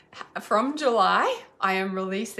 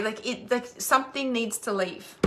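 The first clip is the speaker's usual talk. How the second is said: usual speaking voice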